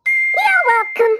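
high, slow squeaky grin; grinning mouse. Acted. Jiggs grinning at Campbell, high and squeaky. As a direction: say it with a cheeky grin. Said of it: "grinned Jiggs".

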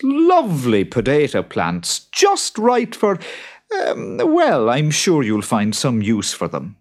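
a hint of a sly farmer. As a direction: play innocent. Jude teasing boggart.

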